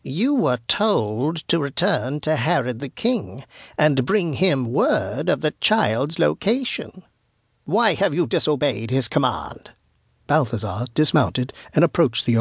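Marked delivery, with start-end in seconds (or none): 0.0-9.7 put-on slow, craggy old witch. Witch rebukes the wise men for disobeying Herod, craggy voice. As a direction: accuse them in a craggy crone's voice, drawn out. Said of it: "she spoke in a craggy voice".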